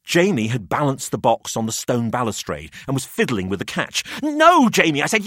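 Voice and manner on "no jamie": high, loud plea to stop him; urgent protest. A narrator's reading. Narrator begging Jamie not to tip the box, loud plea.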